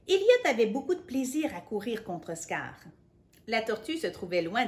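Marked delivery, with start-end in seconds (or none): none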